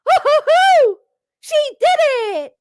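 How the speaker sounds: hammed-up Goofy celebration; cartoon dog cheering, mocking the glee; Goofy-style cheer. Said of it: "Goofy just discovered".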